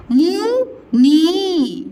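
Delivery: मुनि broken into two long-held syllables; मुनि very slow, each vowel overstretched; wrong long vowels. Shown as a demonstration. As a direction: drag out मुनि as "muuu... niii". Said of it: "मुनि नहीं बोलते".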